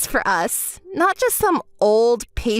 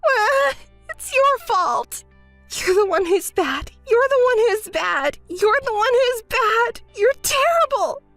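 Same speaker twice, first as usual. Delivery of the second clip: crying; 7 semitones higher than usual. Acted. Breaking into tears.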